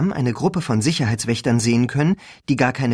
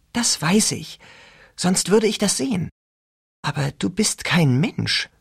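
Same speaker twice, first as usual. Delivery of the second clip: hushed; 6 semitones higher than usual. A narrator's reading. Rachel, hushed.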